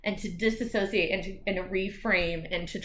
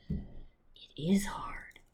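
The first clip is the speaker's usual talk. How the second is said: whispered; 2 semitones lower, 10 dB quieter, much slower than usual. Real, not acted. Sincere aside after imitation.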